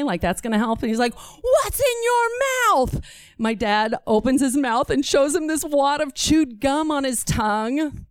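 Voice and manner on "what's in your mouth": high, slow mock scream; imitated scream. Warden screaming at a deaf man anyway, imitated scream. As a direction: stretch each word for a deaf man. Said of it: "he screams anyway".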